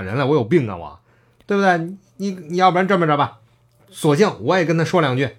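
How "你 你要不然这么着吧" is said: usual speaking voice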